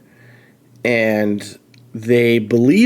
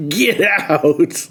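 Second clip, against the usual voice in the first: higher, mock-spooky ghost cry; 5 semitones higher than usual. Streamer reading out a spooky ghost's warning, hammed-up ghost voice. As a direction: play the spooky ghost through a laugh. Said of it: "and it goes".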